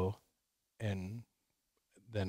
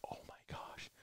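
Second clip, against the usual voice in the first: hushed "Oh, my gosh" barely breathed; whispered awe; 23 dB quieter than usual. Retold or imitated. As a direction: whisper so the deer won't hear. Deer right under tree stand.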